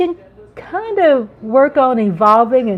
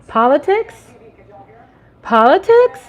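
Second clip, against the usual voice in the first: mock disbelief; 5 semitones higher, 4 dB louder than usual. Mock disbelief at own word.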